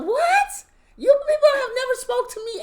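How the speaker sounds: high, loud outraged what; indignant yell. Retold or imitated. Yelling at the nosy neighbor, high and outraged. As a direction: shout back in disbelief at the neighbor.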